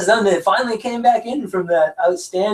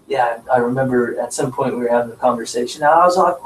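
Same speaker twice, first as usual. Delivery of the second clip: ordinary talk, nothing marked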